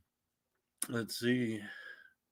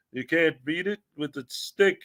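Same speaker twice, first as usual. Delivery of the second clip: ordinary talk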